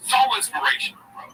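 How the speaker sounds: Schultz impression. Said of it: "Schultz is his character".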